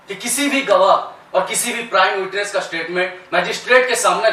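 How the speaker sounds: impassioned courtroom argument, rising from measured to loud; defence lawyer. Acted. Lawyer pleading to the judge against the prosecution, impassioned. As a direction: argue the case with forceful, theatrical emphasis.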